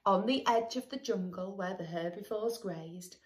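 put-on sing-song read-aloud for children; lilting rhyme for a little listener; storybook narration. A narrator's reading. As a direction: read it like a bedtime picture book. Reading a picture book aloud.

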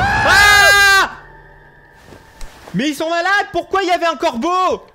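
loud high scream then panicked questions; terrified scream. Real, not acted. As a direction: jump out of your skin. Frayeur dans le jeu. (hurler de peur)

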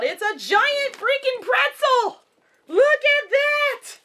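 high, slow delighted squeal; high slow excited gushing; thrilled. Real, not acted. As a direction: gush over the giant pretzel. Thrilled at pretzel toy.

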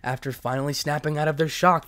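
ordinary delivery, nothing marked

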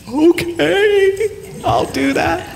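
put-on very high small child voice; timid little girl. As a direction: squeak it out like a shy five-year-old. Daughter agrees, voiced by dad.

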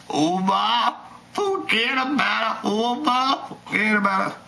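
lightly voiced New York forget about it; a hint of a Jersey tough guy; mock accent. Vlogger imitating a Jersey campaign manager, light accent.

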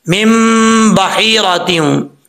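slow reading of मिम बहीरातिहूँ with the म merged; correct recitation. Shown as a demonstration. Teacher models the correct reading for students. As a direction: read मिम बहीरातिहूँ slowly, म joined to ब. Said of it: "आप सुनेंगे तो आपको पता चलेगा".

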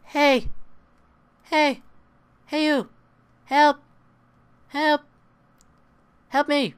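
a squeaky zombie calling hey and help. Acted. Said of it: "and then Zombie's like".

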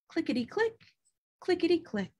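high, soft clicking chant; knitting clicks. Acted. Grandmother's needles clicking, chanted softly. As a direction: tap out the needles' rhythm.

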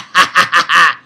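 loud staccato cackle; loud laugh. Real, not acted. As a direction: burst out in a hard, rhythmic laugh. Laughs at the villain's failure.

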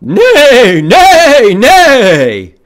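loud, high whinnies in a row; horse neighing. Acted. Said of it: "It's a horse".

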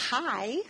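"hi" slow, ending open on the vowel; English word hi. Shown as a demonstration. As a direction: say the example word clearly. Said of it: "Si digo". (palabra "hi" despacio, en inglés)

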